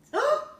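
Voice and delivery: sharp high loud gasp. Real, not acted. Reader shocked at the hungry lion, gasping.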